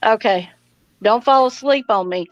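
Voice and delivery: ordinary delivery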